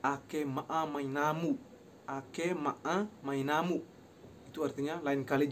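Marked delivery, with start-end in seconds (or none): 0.1-1.6 "Ake maa mainamu" slow, syllable by syllable; slow pronunciation. Shown as a demonstration. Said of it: "Pronunciation, I repeat again". 2.1-3.8 "Ake maa mainamu" slow, syllable by syllable; slow pronunciation. Shown as a demonstration. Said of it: "Pronunciation, I repeat again".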